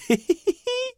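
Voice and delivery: put-on very high delighted child's giggle; childlike giggle. Fífa laughing among the bubbles, high giggle. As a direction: giggle like a happy little boy. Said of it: "A Fíla se smál a smál".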